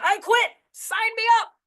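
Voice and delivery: high, loud announcement, thrilled; bursting excitement. Retold or imitated. Woman quitting her job bursts into the office, thrilled and loud. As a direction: burst in shouting the happy news. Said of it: "she went".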